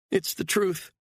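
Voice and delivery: high pleading female voice; frightened woman. A narrator's reading. Captive woman pleading with her kidnapper, high and frightened. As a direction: beg him, scared and small.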